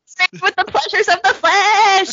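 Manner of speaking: high, mock preacher voice. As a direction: ham up the sermon line.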